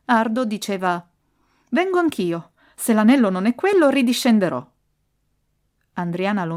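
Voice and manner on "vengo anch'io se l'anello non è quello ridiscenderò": raised, higher arguing voice; insistent protest. A narrator's reading. Ardo disputes with Andriana over the ring, insistent protest. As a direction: argue back louder and higher.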